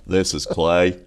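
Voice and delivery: gruffly acted Southern man answering the phone; North Carolina accent. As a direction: answer low with a Carolina twang. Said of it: "North Carolina accent".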